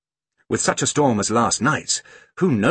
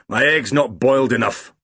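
lightly voiced grumpy grandfather; grumbling grandfather; 3 semitones higher, 3 dB louder than usual. A narrator's reading. Grumpy grandfather complaining about his breakfast egg, grumbling. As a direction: complain like a mildly grumpy old man.

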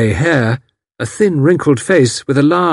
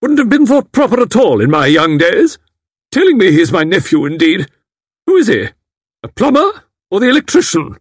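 a much higher, louder indignant scolding; old aunt angrily scolding the matron; angry old lady; 10 semitones higher, 5 dB louder than usual. A narrator's reading. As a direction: rebuke the matron in outrage.

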